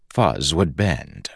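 ordinary delivery, nothing marked

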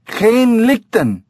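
high, drawn-out call to the ape; loud call. A narrator's reading. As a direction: call the foreign words up into the tree. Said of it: "The heavy-set man said loudly".

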